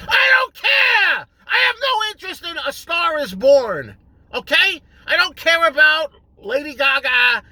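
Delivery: sudden high furious shout, slowed; angry shout. Real, not acted. Venting at a pushy friend, exploding into an angry shout. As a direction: blow up and yell it out.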